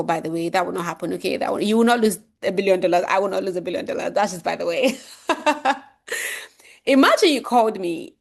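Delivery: usual speaking voice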